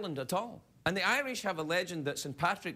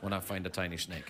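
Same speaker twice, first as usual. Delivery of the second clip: much lower, shifty mumbling excuse; sly husband; 10 semitones lower than usual. Acted. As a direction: play it innocent but give yourself away.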